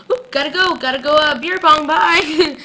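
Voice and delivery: high, loud, breezy brush-off; mock hasty goodbye. Vlogger imitating Damon ducking out of a call, mock hasty. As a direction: call out a hammed-up bye, stretching the last word.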